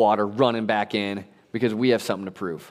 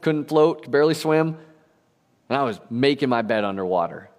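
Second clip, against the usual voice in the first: ordinary talk, nothing marked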